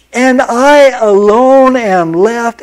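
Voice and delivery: slow, drawn-out self-pitying whine; whiny self-pity. Acted. Elijah moaning to God in a pity party, whiny. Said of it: "he's having this pity party".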